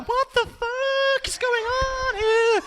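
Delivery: very high, slow baffled dog. Retold or imitated. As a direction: voice the confused family dog. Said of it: "the dog's going".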